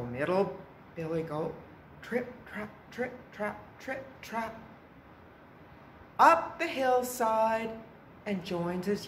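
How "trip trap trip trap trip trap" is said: soft, higher rhythmic trip-trap; light hoofsteps. A narrator's reading. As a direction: tap out the goat's light steps softly. Middle goat trots off.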